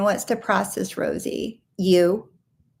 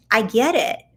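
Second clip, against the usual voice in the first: ordinary delivery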